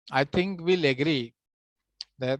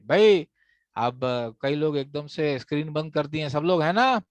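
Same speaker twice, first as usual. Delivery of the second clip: ordinary talk, nothing marked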